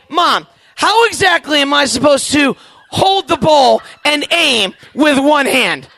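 mock-indignant plea; exasperated question. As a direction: spell out the absurdity to mom.

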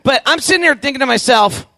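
usual speaking voice

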